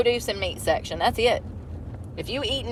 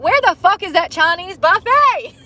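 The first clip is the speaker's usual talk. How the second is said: higher, louder, exasperated; mock frantic search; 8 semitones higher, 6 dB louder than usual. Driver mocking own hungry self, higher and louder. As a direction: hunt for food out loud, higher and louder. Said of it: "You're like".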